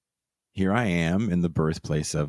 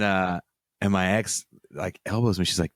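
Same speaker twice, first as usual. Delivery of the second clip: normal talking voice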